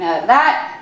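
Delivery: ordinary talk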